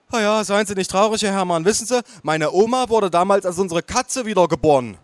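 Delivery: high-pitched waitress voice; consoling waitress. Acted. Waitress consoling with esoteric belief.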